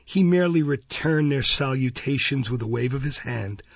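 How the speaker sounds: plain reading voice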